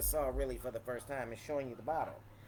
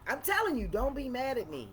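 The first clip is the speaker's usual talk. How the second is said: excited; 9 semitones higher, 4 dB louder, faster than usual. Real, not acted. Playful pleading to viewers.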